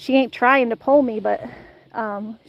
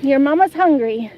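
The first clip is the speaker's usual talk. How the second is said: ordinary talk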